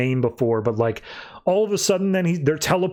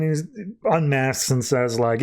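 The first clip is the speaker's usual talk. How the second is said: ordinary delivery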